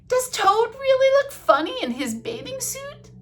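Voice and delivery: very high, loud little creature voice; high-pitched storybook lizards; curious lizards. Acted. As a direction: squeal the question, agog. Lizards voiced in read-aloud story.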